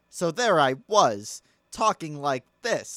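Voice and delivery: weird inflection, "was" and "this" stretched out; slow imitated comic cadence, leaning on "there" and "this"; John Mulaney. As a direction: draw out "there I was" in an odd storyteller lilt. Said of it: "use weird inflection".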